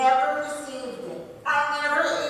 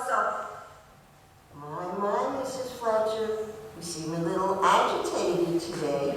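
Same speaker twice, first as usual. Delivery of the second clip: much lower, oily, soothing phone voice; smooth supervisor; 6 semitones lower than usual. Acted. Supervisor Charles soothing the agitated customer, condescending. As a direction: talk her down like a smug call-center boss. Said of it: "the fast and friendly supervisor".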